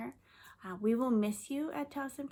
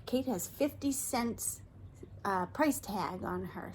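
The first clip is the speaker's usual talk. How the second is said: usual speaking voice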